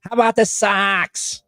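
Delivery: high, drawn-out Boston sports-fan shout-out; Boston accent. Pretend. Teasing a woman from Boston, in a put-on Boston accent. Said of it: "Oh, I'm from Boston".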